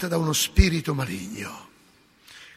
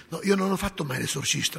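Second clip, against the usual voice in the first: ordinary delivery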